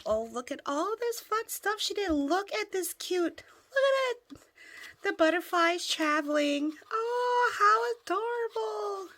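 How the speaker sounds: high, loud squeals of delight; thrilled crafter squealing at a gift; overjoyed squeal. Real, not acted. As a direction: gasp and squeal over every detail. Opening the handmade book.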